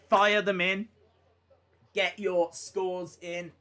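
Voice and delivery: ordinary talk, nothing marked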